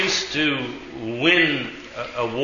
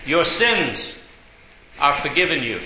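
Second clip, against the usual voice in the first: broken in two by a long pause after "sins". Retold or imitated. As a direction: spell it out for someone not getting it. Repeats slowly for puzzled driver.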